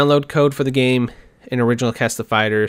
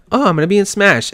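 put-on excited girl voice; 7 semitones higher, 5 dB louder than usual. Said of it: "she's like".

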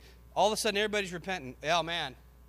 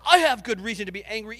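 louder, fast defiant retort; defiant talk-back; 3 semitones higher, 9 dB louder, faster than usual. Retold or imitated. Jonah talking back to God, loud defiant retort. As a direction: snap back like a sulking child. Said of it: "your kids talk back to you like that".

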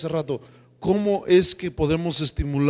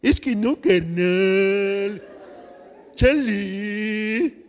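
higher sing-song street slang with a laugh; sing-song barrio talk; 3 semitones higher, much slower than usual. Retold or imitated. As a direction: hear yourself talking like the barrio again. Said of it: "hablamos cantadito". (cantadito de barrio con risa)

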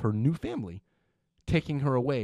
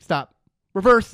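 louder, higher barked order; called-out command; 6 semitones higher, 8 dB louder, slower than usual. Retold or imitated. Civ stops the dancers intermittently, called-out command. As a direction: call it out to halt the dancers.

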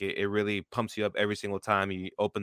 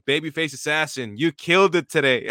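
hyped-up praise; 5 semitones higher, 6 dB louder than usual. Retold or imitated. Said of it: "Lance was like".

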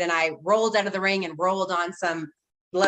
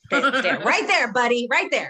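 [name]; 4 semitones higher, 4 dB louder than usual. Retold or imitated. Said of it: "[name] does the voices".

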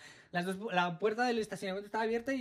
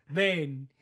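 gruff cop order; 5 semitones lower, 4 dB louder than usual. Retold or imitated. Cop ordering her over.

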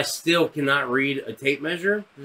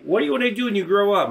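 quick, higher froggy counselor voice; Kermit the Frog; 5 semitones higher, faster than usual. Retold or imitated. Counselor asking about career plans, lightly froggy. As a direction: lightly imitate a puppet frog, rattling it off. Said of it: "This guy sounded like Kermit the Frog".

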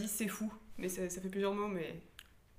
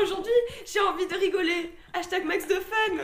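laughing; 8 semitones higher, 7 dB louder than usual. Shown as a demonstration. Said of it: "ça aurait pu s'arrêter à".

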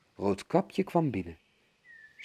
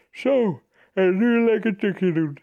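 voldane wolf; 8 semitones higher, 7 dB louder than usual. A narrator's reading.

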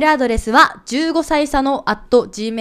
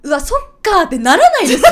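laughing mock reaction; 5 semitones higher, 5 dB louder, faster than usual.

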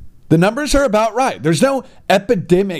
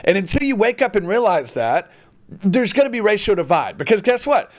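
ordinary talk

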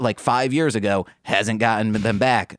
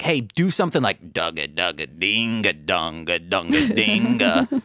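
sewing machine noise; 5 semitones lower than usual. Retold or imitated. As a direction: rattle it off like a machine. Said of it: "be like".

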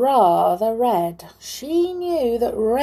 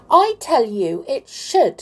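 put-on higher, louder childish insistence; stubborn and raised in a squabble; insistent; 7 semitones higher, 6 dB louder than usual. A narrator's reading. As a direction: argue back, sure you're right. Quarrel, Amelia insists.

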